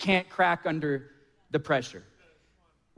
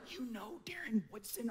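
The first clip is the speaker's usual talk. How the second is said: retold hushed disbelief, much softer; starstruck hushed aside; 3 semitones higher, 17 dB quieter than usual. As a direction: act out an amazed whisper to a friend.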